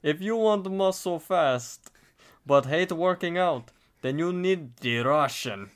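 put-on high-pitched opening in a Russian accent. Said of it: "your Russian accent is pretty good".

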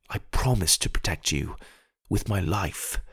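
soft, low, tender promise. Acted. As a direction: gently reassure a little sister.

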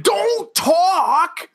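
mock shout. Imitating angry host.

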